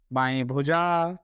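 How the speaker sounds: usual speaking voice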